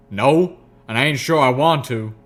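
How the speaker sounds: loud uneasy country voice; uneasy country mare. Acted.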